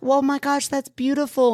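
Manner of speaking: high-pitched, touched gush; moved gushing. Retold or imitated. Stranger reacting to her story, high moved gushing. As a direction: imitate someone overcome by a lovely story.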